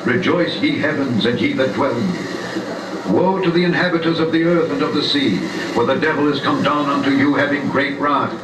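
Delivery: normal talking voice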